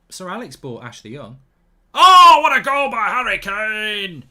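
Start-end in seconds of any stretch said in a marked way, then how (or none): 1.9-4.2 booming, slow Scottish commentator roar, much higher. Acted. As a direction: bellow it like a Scottish TV commentator losing it. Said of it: "I don't know why I'm saying speaking Scottish".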